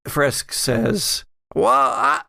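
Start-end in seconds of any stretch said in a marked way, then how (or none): 1.5-2.2 deputy voice. Acted. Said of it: "what was Fresk's voice?".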